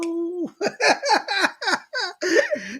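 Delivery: a bright, high laugh to open the show; big opening laugh. Real, not acted. Host laughing to greet his viewers, bright laugh.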